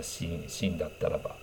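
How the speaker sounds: usual speaking voice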